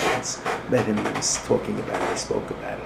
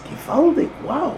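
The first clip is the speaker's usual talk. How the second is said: much higher, amazed exclamation. Retold or imitated. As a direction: gasp it out in wonder. Rebbe marvels.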